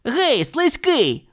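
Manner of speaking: slow, high mocking call; Nick calling out. A narrator's reading. Nick taunts the python before swinging the pan, slow mock call. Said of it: "гукнув Нік".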